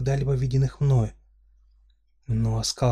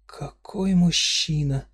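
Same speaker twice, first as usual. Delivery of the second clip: admiring gasp; 5 semitones higher, much slower than usual. Acted. Heroine awed by stranger.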